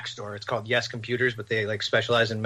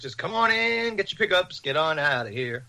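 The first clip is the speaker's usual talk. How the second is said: breezy usher; 3 semitones higher than usual. Acted. Dispensary waving cars through for pickups, breezy mock patter. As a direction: usher customers along in a raised voice.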